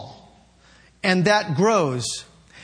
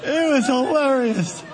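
crying with laughter, higher; laughing to tears; 3 semitones higher than usual. Real, not acted. Preacher to his congregation, crying with laughter. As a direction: laugh till you cry. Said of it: "Boy, I'm crying".

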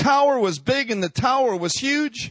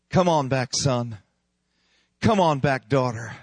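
tender call; 6 semitones lower than usual. Acted. Father calling child back.

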